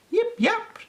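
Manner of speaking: squeaky little puppy bark; high puppy yip. A narrator's reading. Storyteller barks as little Peppy, squeaky high yip. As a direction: yip it out high like a tiny pup. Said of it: "barked Peppy".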